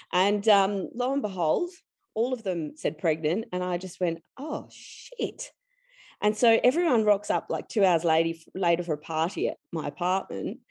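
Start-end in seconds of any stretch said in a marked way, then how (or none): 4.4-5.5 stunned hush. Retold or imitated. All tests said pregnant.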